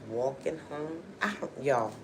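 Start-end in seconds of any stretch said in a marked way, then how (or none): none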